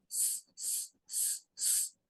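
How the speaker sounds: long soft ssss like a grass snake; drawn-out soft s; snake hiss. Shown as a demonstration. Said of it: "Повторюємо".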